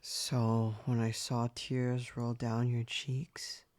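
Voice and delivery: ordinary delivery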